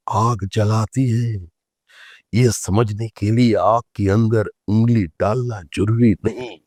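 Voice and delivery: slow, drawn-out imitation of an orator's comeback; Atalji's retort. Leader answering a heckler about not marrying, slow imitated retort. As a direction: stretch each phrase like a statesman delivering a punchline. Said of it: "তো অটলজি সঙ্গে সঙ্গে তার উত্তর দিলেন".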